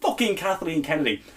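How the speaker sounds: usual speaking voice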